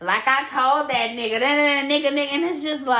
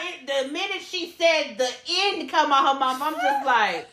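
ordinary delivery

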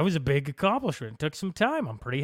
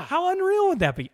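high, loud and thrilled; can't contain the fun; excited; 9 dB louder than usual. Real, not acted. Urging his co-host to make the spoof, excitedly loud. Pitching a spoof video idea.

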